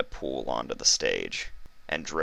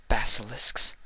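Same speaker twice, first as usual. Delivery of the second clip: stunned question; 6 semitones higher, 4 dB louder than usual. Acted. Stunned princess repeats.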